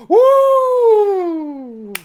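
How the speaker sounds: high whooping cheer; excited cheer. Real, not acted. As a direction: whoop in delight. GM cheers at roll.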